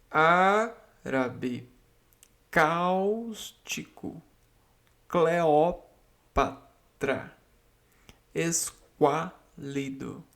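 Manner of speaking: each word said very slowly, stressed syllable held; slow word list. Shown as a demonstration. Teacher reading the word list to students, slow and deliberate. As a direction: read every word out slowly, leaning on its stress. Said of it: "Então, vamos lá".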